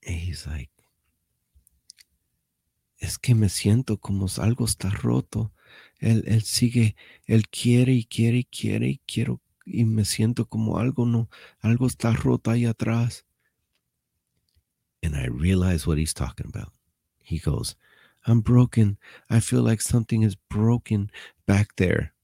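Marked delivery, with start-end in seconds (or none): 3.0-13.2 higher-pitched pleading Spanish complaint, acted out; imitating distressed inmate. Inmate confessing his injury to the translator, mock-distressed. 18.3-22.1 higher, louder acted lament; imitating distressed inmate. Inmate admits to the translator he is hurt, mock-distressed.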